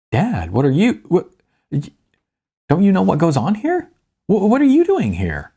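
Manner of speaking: panicked son stammering back at his dad; flustered stammering son. Acted. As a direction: sputter like a caught son. Flustered son caught by father.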